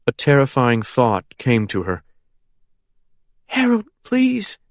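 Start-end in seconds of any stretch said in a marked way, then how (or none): 3.5-4.6 high, slow breathless plea; gasping. A narrator's reading. Wounded woman gasps a plea to her captor. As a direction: gasp out the plea. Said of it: "she gasped".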